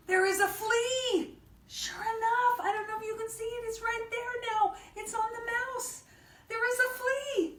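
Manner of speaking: high-pitched amazed storyteller; high, wonder-struck reading for little kids; excited discovery. Acted. Excited discovery while reading to children.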